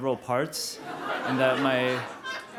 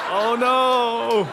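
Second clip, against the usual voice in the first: mock dismay; 9 semitones higher, 7 dB louder than usual. Hearing high lasts two days.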